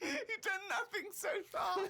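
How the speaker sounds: high squeal through real laughter; laughing incredulity. Real, not acted. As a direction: exclaim it while cracking up. Laughing at the empty diary.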